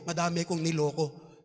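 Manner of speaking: soft, low, contrite plea to the Lord; humble tax collector's prayer. Retold or imitated. Sinner confessing to God, soft and humble. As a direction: beg forgiveness quietly, head bowed. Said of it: "Humihingi siya ng sorry".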